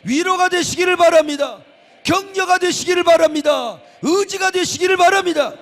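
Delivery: high, loud, ringing blessing; fervent preacher's climax; preaching at full force. Acted. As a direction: drive the blessing home to the congregation.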